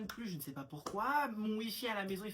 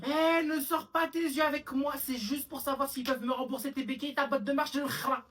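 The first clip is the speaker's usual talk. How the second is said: loud, higher, telling off; scolding mother; 7 semitones higher, 7 dB louder than usual. Acted. A mother loudly scolding her son. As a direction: snap at the son like an angry mum.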